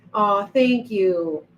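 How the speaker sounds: usual speaking voice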